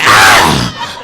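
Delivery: 2 his own hammed-up yelp, low and loud; 3 a comic yelp of panic; 1 mock scream. 4 husband jumping away from the mouse, a mock yelp. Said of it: "I'm like".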